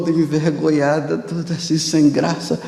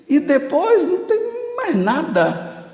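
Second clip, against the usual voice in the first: flustered. Retold or imitated. Soldier flustered by questions.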